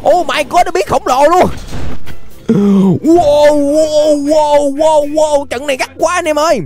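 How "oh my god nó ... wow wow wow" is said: usual speaking voice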